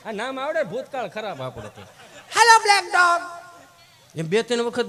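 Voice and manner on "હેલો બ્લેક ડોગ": prissy English dog-calling; mock call to dog. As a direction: coo at the dog like a fancy visitor. Visitor calling again.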